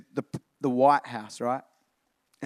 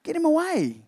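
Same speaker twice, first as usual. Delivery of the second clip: brushing him off; 12 semitones higher, 3 dB louder than usual. Pretend. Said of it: "he's gonna be like".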